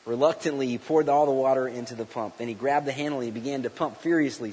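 ordinary delivery

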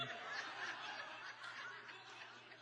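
high airless giggle; stifled laugh at the question; breathy silent laugh. Real, not acted. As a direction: laugh without voice. Laughs at being credited.

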